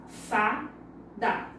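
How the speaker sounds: low-pitched "Fada" with a pause after fa; syllable by syllable. Shown as a demonstration. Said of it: "Duas sílabas".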